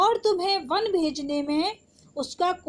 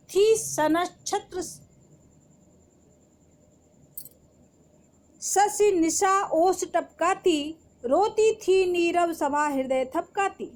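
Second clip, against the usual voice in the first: poem recitation; 3 semitones higher than usual. Acted. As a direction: recite the stanza with feeling, as Kaikeyi's plea. Teacher recites Kaikeyi's verses. (भावपूर्ण कविता पाठ)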